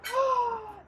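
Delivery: high, startled intake of breath; big storyteller's gasp; surprised gasp. Acted. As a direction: gasp in mock astonishment. Princess discovers basket in river.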